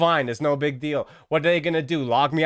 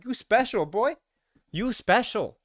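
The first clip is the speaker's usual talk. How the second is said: mock flattering admiral; 8 semitones higher, slower than usual. Admiral flattering, voiced high.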